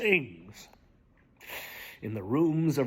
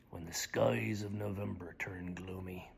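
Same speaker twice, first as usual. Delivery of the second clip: whisper; 8 semitones lower, 11 dB quieter than usual. Acted. Dramatic poem recitation.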